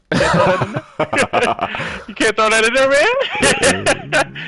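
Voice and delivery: loud, high laughing protest; laughing disbelief. Real, not acted. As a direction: cry it out incredulously through laughter. Laughing plea to the player.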